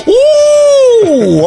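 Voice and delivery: high, loud, held whoop; drawn-out "ooh". Retold or imitated. Host acts out his own reaction, long high whoop. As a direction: let out a long excited "oooh". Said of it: "Yeah, I go".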